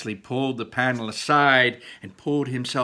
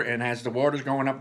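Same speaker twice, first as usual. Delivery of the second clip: ordinary delivery, nothing marked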